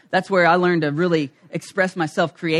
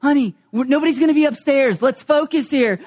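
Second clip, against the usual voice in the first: high, pressed urging to focus; frantic pleading; 7 semitones higher than usual. Retold or imitated. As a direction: insist in a hurry, half arguing. Arguing with wife while cleaning.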